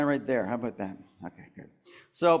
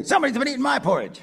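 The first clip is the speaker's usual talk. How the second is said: higher, fast mother bear voice; Mama Bear; 7 semitones higher, much faster than usual. Shown as a demonstration. Performer feeds Mama Bear her line, higher and quick.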